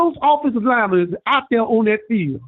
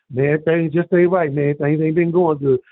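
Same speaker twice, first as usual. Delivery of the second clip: lower, slow, glum voice for the friend; friend Sweet Willie; 7 semitones lower than usual. Retold or imitated. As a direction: drop low and drag it out, down in the dumps. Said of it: "I said / Sweet Willie reply".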